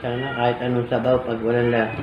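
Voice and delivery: speaking between bites; talking while chewing. Real, not acted. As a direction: mouth partly full of food. Seen 0: Food goes to mouth just before; she keeps picking food, mouth working.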